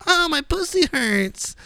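high, slow whiny whimper; whiny mock voice. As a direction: play a hammed-up crybaby. Mocking Gen Z meme quote.

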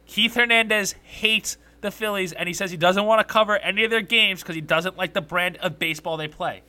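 brace them, then say it loud; loud announcement. Real, not acted. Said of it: "plug your ears ... as I say this".

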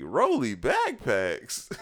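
high, stretched-out incredulous echo; mock disbelief. Kids looking at each other over the gift, incredulous. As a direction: drag the words out in high disbelief. Kids' letdown at gift, retold.

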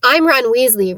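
ordinary delivery

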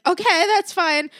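slow high mock surrender; caving in. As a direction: give up with a sing-song okay. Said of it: "I'm just like".

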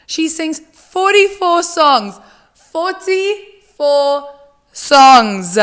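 high, slow, stretched out in disbelief; Forty-four said slowly, high and amazed; amazed emphasis. Real, not acted. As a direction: drag out forty-four in awe. Disbelief at song count.

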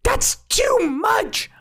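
very high, drawn-out wail; slow, shrieking objection; outraged protest. Acted. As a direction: stretch it into a high squeal of protest. Mole balks at the power demanded.

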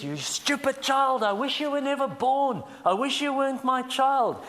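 put-on sneering parent berating a child; abusive parent. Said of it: "maybe verbal abuse".

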